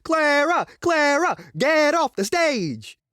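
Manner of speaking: high-pitched imitation of heckling; mock crowd yell. Colleague imitates hecklers yelling Clara off stage, mock yell. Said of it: "they were yelling to get her off".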